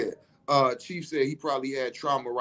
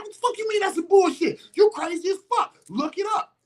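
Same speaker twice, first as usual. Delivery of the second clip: heated shout. Retold or imitated. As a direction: yell it like you can't believe him. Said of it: "see how I'm going right now? That's the energy I had".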